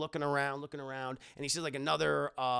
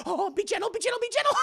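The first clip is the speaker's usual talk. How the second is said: much higher, rising desperate pleas; high pleading cry; 5 dB louder, faster than usual. Acted. Indy squealing at Willie, hammed-up and begging. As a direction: squeal it like an overexcited lover.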